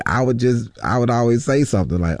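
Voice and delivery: ordinary talk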